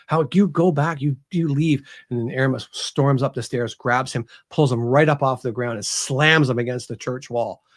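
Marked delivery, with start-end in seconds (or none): 0.1-1.9 higher, mildly outraged order; a touch of a priest freaking out; retold freak-out. Author voices priest ordering Aramis away, mildly outraged. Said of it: "he's just freaking out".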